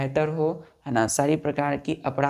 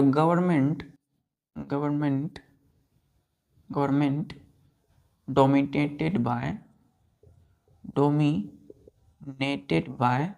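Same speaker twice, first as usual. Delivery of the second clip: slow dictation; much slower than usual. Shown as a demonstration.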